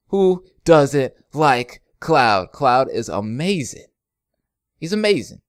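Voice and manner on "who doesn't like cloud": slow, louder, stretched out; drawn out. Real, not acted. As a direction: drag every word out for emphasis.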